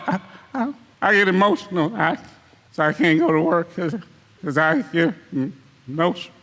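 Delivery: a lightly voiced, stammering, half-hearted weepy excuse; mock tearful excuse. Preacher mimics member too emotional to work, mock weepy.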